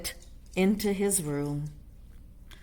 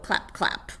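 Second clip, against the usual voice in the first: unvoiced whispered "clap, clap". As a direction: breathe "clap, clap" with no voice. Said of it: "He began to sing".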